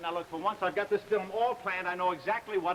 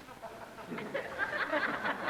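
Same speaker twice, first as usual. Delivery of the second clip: embarrassed laugh; 8 semitones higher, 4 dB quieter than usual. Acted. Laughing at own outburst.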